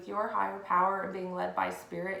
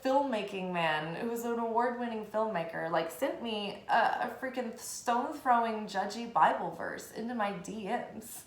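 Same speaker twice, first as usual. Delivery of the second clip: ordinary talk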